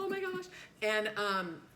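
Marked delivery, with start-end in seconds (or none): 0.0-0.4 panicked. Retold or imitated. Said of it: "You know how we all get that".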